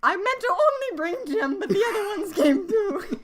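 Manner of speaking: high, loud put-on Countess voice, laughing through it. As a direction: ham up the grand Countess. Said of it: "the Countess accent like I do".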